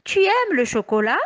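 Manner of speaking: «Tu aimes le chocolat?» with the voice climbing on «aimes» and rising at the end; question intonation. Shown as a demonstration. Teacher repeating the question to the children, voice rising. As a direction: ask it warmly as a real question, lifting at the end. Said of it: "j'ai posé une question".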